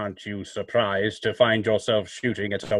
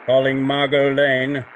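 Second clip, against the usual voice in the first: louder, drawn-out summons; distant mental call; 2 semitones higher, 4 dB louder than usual. Acted. The Shadow summons Margot Lane from afar, slow ringing call. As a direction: call out to her across the distance.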